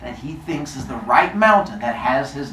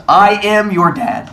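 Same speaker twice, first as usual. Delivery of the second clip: slow, loud, higher declaration; emphatic revelation; 6 semitones higher, 7 dB louder than usual. Acted. Titan telling his son the truth, emphatic. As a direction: proclaim it with weight on each word.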